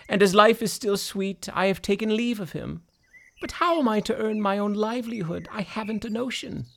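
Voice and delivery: high, worn-out dog voice; tired old dog. Acted. Old dog tells his plight.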